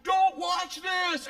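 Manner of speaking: high, drawn-out holler; shouted warning. Retold or imitated. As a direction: yell it slowly before jumping. She shouts before jumping.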